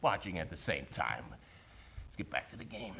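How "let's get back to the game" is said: whispered aside. Acted. Character's quiet aside.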